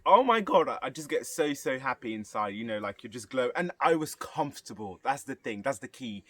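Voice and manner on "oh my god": delighted exclamation. Retold or imitated. Delighted reaction to birthday song.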